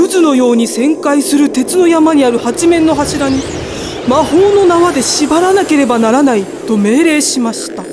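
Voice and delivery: ordinary delivery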